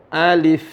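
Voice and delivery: ALIF said as the full letter name; letter-name reading. Shown as a demonstration. Teacher showing how to read alif lam mim, careful "alif". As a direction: say "alif" clearly with a final f. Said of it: "Cara bacanya".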